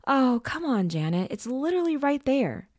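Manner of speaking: loud, high-pitched whine with swooping pitch; whining. A narrator's reading. Brother begs his sister to let him fetch the kite, whining. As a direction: whine and plead, voice pushed up high. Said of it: "gesturing towards the kite with both hands and whining".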